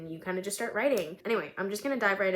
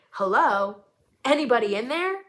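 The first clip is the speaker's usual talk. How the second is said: loud, higher, impatient hello; calling out; 4 semitones higher, 6 dB louder than usual. Acted. Angry woman calling to the dazed driver, loud and impatient. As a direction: snap someone out of a daze from across the car.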